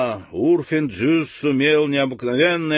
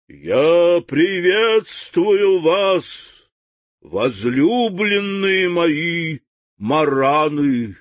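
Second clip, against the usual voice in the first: громкий медленный напевный голос с неба; возвестить громово и нараспев; громовой голос; 5 semitones higher, much slower than usual. Acted. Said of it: "громовой голос". (громовой медленный голос)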